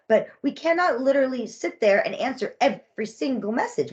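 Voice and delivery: ordinary delivery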